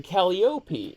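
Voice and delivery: Calliope as KAL-ee-OH-pee; alternative Calliope pronunciation. Retold or imitated. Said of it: "I've heard it pronounced".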